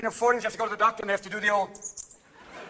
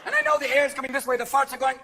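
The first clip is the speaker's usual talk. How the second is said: normal talking voice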